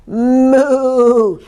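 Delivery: long, drawn-out moo; cow bellow. A narrator's reading. Cow joining the noisy stable, long bellowed moo. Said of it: "bellowed Cow".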